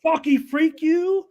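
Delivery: high, loud, slow taunting yell; playful shout. Real, not acted. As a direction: yell it at your buddy. Shouts his bet at Nick.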